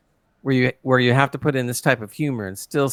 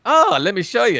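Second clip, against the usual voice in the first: eager Kirk; 10 semitones higher, 3 dB louder than usual. Retold or imitated. Kirk retold, eager to explain.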